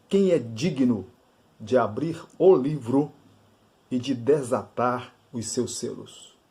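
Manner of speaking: ordinary delivery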